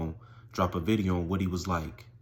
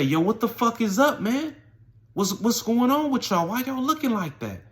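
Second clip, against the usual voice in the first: high-pitched, animated mock demand; agitated questioning; 13 semitones higher, 4 dB louder than usual. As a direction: act out a baffled, worked-up challenge. Acted quote, confronting shaken friends.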